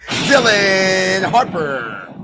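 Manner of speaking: long slow yelled name; drawn-out shout. Real, not acted. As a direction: stretch the name out in a roaring call. Streamer calls a made layup.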